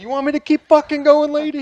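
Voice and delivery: loud, very high mock yell; furious retold threat. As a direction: act out an enraged contractor mid-demolition. Retold contractor threatening while smashing.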